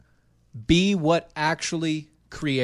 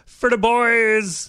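mock slogan; 8 semitones higher, 8 dB louder, much slower than usual.

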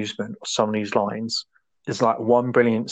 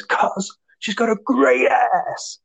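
mock manic yell, pitched way up; imitated deranged outburst; 12 semitones higher, slower than usual. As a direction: draw it out high, imitating an unhinged cop. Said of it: "he just completely goes off at it".

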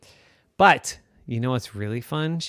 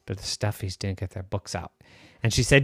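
ordinary delivery, nothing marked

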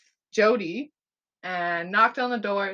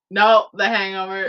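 loud gloating no; 11 dB louder than usual. Real, not acted. Gloating over the wrong guess.

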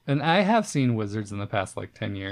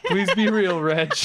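laughing plea; 6 semitones higher than usual. Pretend.

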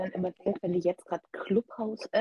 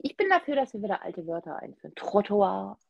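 usual speaking voice

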